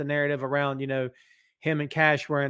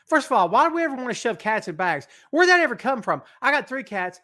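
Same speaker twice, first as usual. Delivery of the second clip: animated rant; 8 semitones higher, 5 dB louder than usual. Real, not acted. Animated rant about cats.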